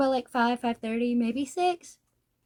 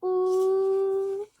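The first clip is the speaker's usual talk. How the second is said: mock tender coo; 5 semitones higher than usual. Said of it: "and it's like".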